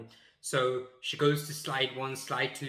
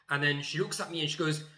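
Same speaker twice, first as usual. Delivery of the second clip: ordinary talk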